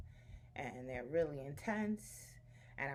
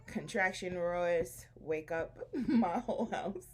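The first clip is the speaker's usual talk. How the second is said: amused, louder, breaking into a laugh; 6 dB louder, slower than usual. Real, not acted. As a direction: laugh through the words, slowly. Laughs while telling her story.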